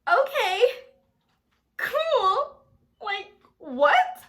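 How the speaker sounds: very high, loud, squeaky okay; awkward agreement. Retold or imitated. Naive girl agreeing to mom's pickup, awkwardly high. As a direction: force out a high-pitched cool. Feigned ease, then disbelief.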